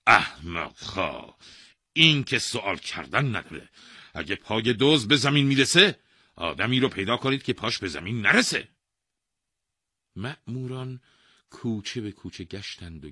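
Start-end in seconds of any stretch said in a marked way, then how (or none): 0.1-8.7 loud, high-rising royal temper; angry king. A narrator's reading. King scolding his officers, angry. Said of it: "با عصبانیت گفت".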